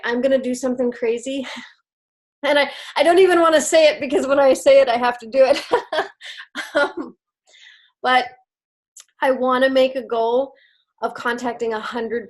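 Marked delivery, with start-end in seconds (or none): 5.7-6.7 short breathless giggle. Real, not acted. Laughing at herself after daring to say her goal. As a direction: laugh off the nerves of saying it. Embarrassed at own big goal.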